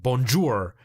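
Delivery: 'bonjour' as bon-joor with a hard English R; English final R. Shown as a demonstration. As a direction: close 'bonjour' on a full English R. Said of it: "other people that pronounce the R".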